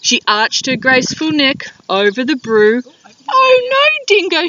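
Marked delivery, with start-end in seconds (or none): 3.3-4.5 high, louder fluttering lady voice; fluttery emu. Acted. Emu fussing over Dingo's brew in a high flutter. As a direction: flutter up high like a fussy bird. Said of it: "she fluttered".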